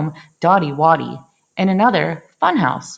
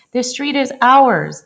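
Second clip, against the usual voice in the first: defiant; 6 semitones higher, 4 dB louder than usual. A narrator's reading. Neighbors' defiant quote.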